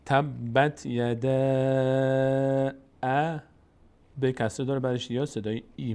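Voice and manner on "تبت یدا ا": "تبت یدا" slowly, last vowel greatly prolonged; recited madd. Shown as a demonstration. Teacher reading out the example with madd, long sustained vowel. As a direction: hold the thin end of "یدا" long before "ا". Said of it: "مد الان".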